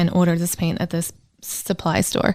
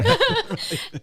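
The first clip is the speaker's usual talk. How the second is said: high giggle; 4 dB quieter than usual. Real, not acted. Laughs at being teased.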